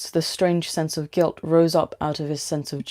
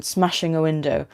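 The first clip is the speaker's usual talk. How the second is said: ordinary delivery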